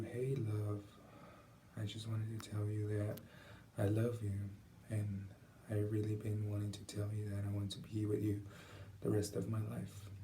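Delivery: soft, sincere and steady; authentic, calm proposal. Shown as a demonstration. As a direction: say it calmly, like you truly mean it. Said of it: "in this voice".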